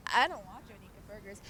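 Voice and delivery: soft, breathy-free disgusted mutter under the breath. Retold or imitated. Narrator replaying friend's disgusted line to viewers, quiet disgust. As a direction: repeat it as a quiet sneer. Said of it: "she said it disgusted".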